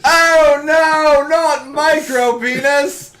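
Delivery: high, slow, wailing mock dismay; mock horror. Comic horror at the doctor's diagnosis, wailing. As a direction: wail it like a tragic verdict.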